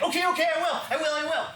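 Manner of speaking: put-on high, quick little-girl promise; eager little girl. Little girl promising her father, high eager voice. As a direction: promise like an excited small child. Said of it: "my mom was like".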